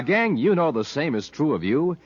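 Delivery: usual speaking voice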